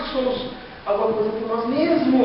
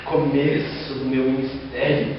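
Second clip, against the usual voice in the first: slow, quiet and self-satisfied; smug inner thought; 8 semitones lower, 4 dB quieter, slower than usual. Retold or imitated. Young preacher thinks his ministry is starting, slow and smug. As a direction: savour it like a proud milestone. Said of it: "Eu pensei".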